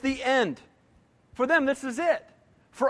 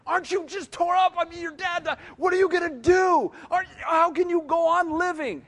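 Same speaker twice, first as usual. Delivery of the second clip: higher, incredulous, fretting voice; mock unbeliever; 7 semitones higher than usual. Preacher mimics an unbeliever pressing a grieving believer, high and incredulous. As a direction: play the unbeliever aghast at calm grief. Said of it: "Unbelievers don't get it".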